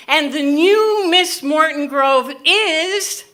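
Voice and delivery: high, loud, drawn-out announcer voice; stretched-out mock announcement, left hanging; pageant announcer. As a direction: build suspense like a pageant host. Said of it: "as they were saying".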